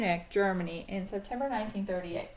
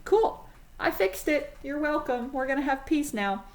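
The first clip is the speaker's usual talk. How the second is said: much higher, chirpy mock pride; smug self-satisfaction; 5 semitones higher than usual. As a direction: pat yourself on the back, naively pleased. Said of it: "And Chamberlain goes".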